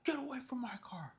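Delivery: high, whispered mock threat; lightly voiced angry car owner; imitated angry guy. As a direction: hiss a warning off the car.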